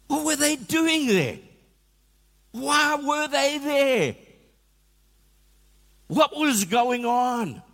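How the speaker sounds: high, slow, mock-baffled questioning; incredulous questions. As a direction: ask it in high, slow disbelief. Incredulous questions about the onlookers.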